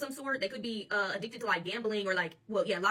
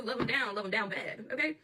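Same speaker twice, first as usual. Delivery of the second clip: ordinary talk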